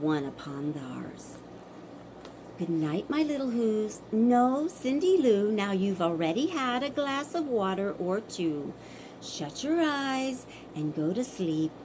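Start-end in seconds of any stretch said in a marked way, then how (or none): 2.6-11.7 tender goodnight. Real, not acted. Parent tucking Cindy Lou in, soft and tender. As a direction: ease the little one toward sleep.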